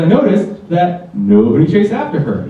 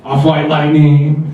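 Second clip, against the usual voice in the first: ordinary talk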